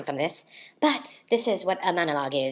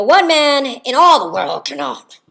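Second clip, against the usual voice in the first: high, loud, slow tyrant's proclamation; Caesar; 9 semitones higher, 11 dB louder, slower than usual. Shown as a demonstration. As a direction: bellow it like a ruthless ruler. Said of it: "if I were to be Caesar".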